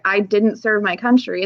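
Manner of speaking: ordinary delivery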